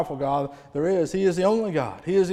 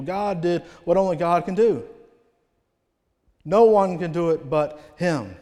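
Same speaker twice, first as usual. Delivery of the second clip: louder, higher revival preaching; preaching at full force; 5 semitones higher, 6 dB louder than usual. Acted. Preacher to the revival church about God coming down, at full force. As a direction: drive it home to the congregation.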